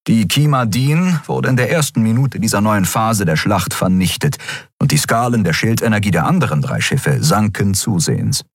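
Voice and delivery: ordinary delivery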